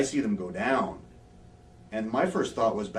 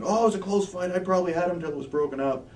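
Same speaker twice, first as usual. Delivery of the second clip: high, raised whiny-excuse imitation; mocking excuse; 10 semitones higher, 5 dB louder than usual. Beaten fighter claims a close fight, mock protest.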